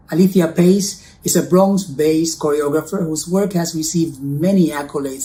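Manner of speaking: ordinary talk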